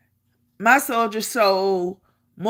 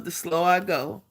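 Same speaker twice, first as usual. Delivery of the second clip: ordinary delivery, nothing marked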